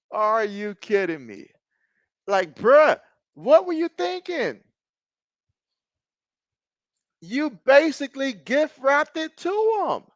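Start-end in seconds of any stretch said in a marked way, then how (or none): 2.3-10.0 high, pleading outrage; exasperated rant. Real, not acted. Fan scolding his quarterback after a pick six, high-pitched. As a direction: scold him for the throw.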